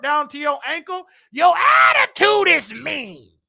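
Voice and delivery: loud, slow scolding; slow, loud call-out. Real, not acted. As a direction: spell out her attitude to her face. Scolding the mean church woman.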